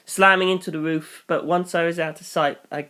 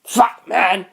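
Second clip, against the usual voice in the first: louder, higher hoarse cry of despair; anguished yell; 5 semitones higher, 3 dB louder than usual. Acted. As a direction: yell out of helplessness. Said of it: "Fukushiki yelled ... more anguished than angry".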